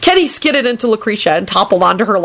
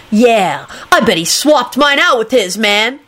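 raised, needling accusation; spiteful; 4 semitones higher, slower than usual. A narrator's reading. Kenny accusing the absent wizard, spitefully. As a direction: accuse him with petty spite. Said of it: "Kenny said spitefully".

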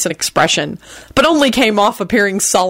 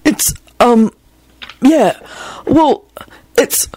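halting, higher-pitched nervous fumbling; tongue-tied; 6 semitones higher, slower than usual. A narrator's reading. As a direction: fumble for words, pausing between each. Neville tongue-tied, forgets why he came.

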